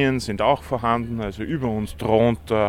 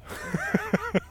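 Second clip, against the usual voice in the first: short high-pitched burst of laughter; 5 dB quieter than usual. Real, not acted. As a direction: laugh it off brightly. Witz über Salzamt-Spruch. (kurzes hohes Lachen)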